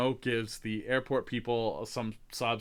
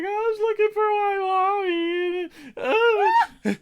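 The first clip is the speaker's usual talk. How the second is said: very high, slow fake-sobbing little kid; mock crying child; 7 dB louder than usual. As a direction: whimper it out like a lost child faking tears. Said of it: "starts crying".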